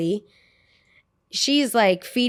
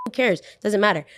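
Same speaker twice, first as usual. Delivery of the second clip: ordinary talk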